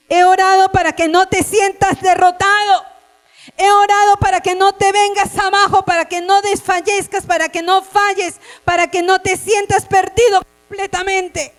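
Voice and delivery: loud, high, impassioned sermon cry; fervent preaching. Acted. Preacher assuring struggling youth, impassioned loud preaching. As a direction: preach it at full force, pleading. Preacher pleads, rising to shouts.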